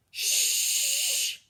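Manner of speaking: sharp unvoiced shush; hushing shh. Librarian hushing the loud girl, sharp shush. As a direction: hush her right now.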